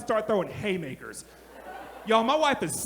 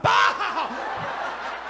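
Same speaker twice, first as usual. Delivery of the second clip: mock punch grunt. Sucker-punching his wife mid-argument.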